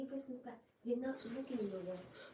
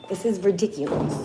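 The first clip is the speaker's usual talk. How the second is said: fed-up outburst; 13 dB louder than usual. Real, not acted. Mom scolding son about hat.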